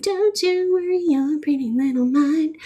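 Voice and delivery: put-on high, lilting New York-accent lyric line. Quoting the [name] song back to a friend, high and lilting. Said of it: "And then I said".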